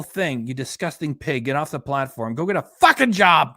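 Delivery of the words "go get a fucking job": loud barked insult; angry yell. Real, not acted. Yelling at the streamer during his rant. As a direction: yell it at her.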